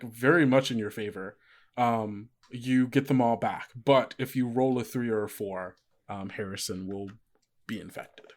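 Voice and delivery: ordinary delivery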